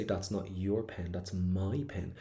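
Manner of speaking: "your" and "my" drawn out slowly in contrast; stress on your and my. Shown as a demonstration. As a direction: lean long on "your", then on "my". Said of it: "do this weird thing with your voice".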